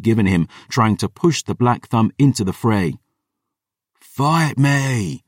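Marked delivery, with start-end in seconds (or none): 4.0-5.2 higher, restrained roar in character; fighter's goading roar, held back; roared challenge. A narrator's reading. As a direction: roar out a challenge without full volume. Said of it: "he'd roar".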